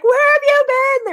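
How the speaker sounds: high, slow mock greeting; mock excited clerks. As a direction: play the gushing store staff. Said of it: "They were like".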